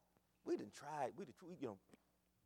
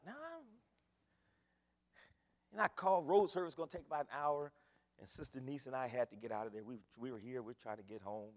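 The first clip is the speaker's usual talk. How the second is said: usual speaking voice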